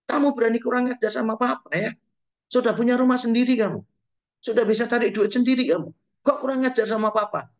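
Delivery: mock-scold the cheeky child; angry parent rebuking a disrespectful child; angry father. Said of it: "Kalau marah dengan anak kita".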